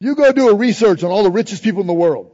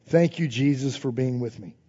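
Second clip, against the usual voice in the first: low and much softer, intimate; soft prayer; 6 semitones lower, 6 dB quieter than usual. Acted. Preacher modelling prayer, soft hushed thanks. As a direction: drop to a hushed thank-you.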